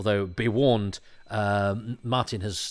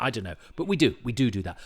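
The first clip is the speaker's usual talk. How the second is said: ordinary delivery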